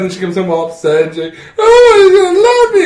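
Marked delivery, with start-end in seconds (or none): 1.6-2.9 high wailing fake cry; hammed-up weepy falsetto; mock sobbing. Retelling the sister running off upset, mock crying.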